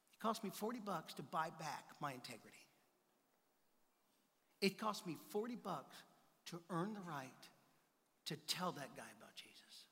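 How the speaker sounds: low, soft reflection; soft sermon point. Real, not acted. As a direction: let it land softly and low.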